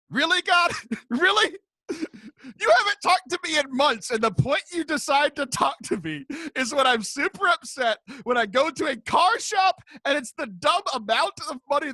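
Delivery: high, loud protest with an incredulous edge; exasperated rant, retold. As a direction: rant up at God in hammed-up outrage. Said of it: "I was so mad".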